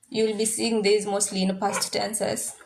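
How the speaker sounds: ordinary talk, nothing marked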